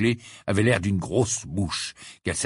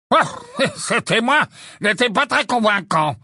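scornful, with a dismissive laugh; haughty; 12 semitones higher, 5 dB louder than usual. A narrator's reading. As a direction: look down on the witnesses, chuckling. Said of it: "d'un air hautain".

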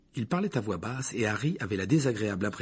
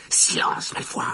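sharp whisper; Harry, à voix basse; slower than usual. A narrator's reading. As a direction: shut him up under your breath. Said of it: "répéta Harry". (chuchotement impérieux)

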